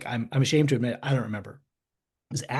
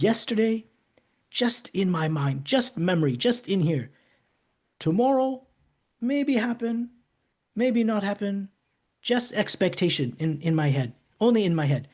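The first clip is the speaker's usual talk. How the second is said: voicing the Dalai Lama; 8 semitones higher, 3 dB louder, slower than usual. Retelling the Dalai Lama's words.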